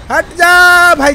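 long loud yell to move aside; drawn-out shout. Real, not acted. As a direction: stretch the order out at full volume. Shouting at a vehicle blocking him.

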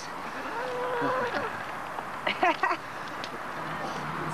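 long laugh, trailing off; long high laugh. Real, not acted. Laughs after calling chickens.

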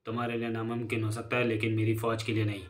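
low-key, softly spoken gang boss; crime boss. Acted. Drug boss briefing his gang about the lost sugar packet, quiet and controlled.

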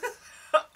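high airy laugh; breathy laugh. Real, not acted. Laughing at her aching.

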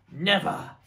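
loud, emphatic outburst; defiant cry. Acted. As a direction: cry out in firm denial. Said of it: "cried Mister Fox".

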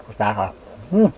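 talking while chewing food; muffled through mouthful; mouth full, chewing. Real, not acted. Seen 0: Arm hides mouth early; bites food at 2.5, chews after.

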